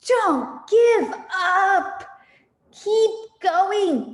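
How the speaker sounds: high, loud slow urging with a laugh; panting Mister Fox. Acted. Mister Fox panting to his children not to give up. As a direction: pant it out, spurring them on. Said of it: "panted Mister Fox".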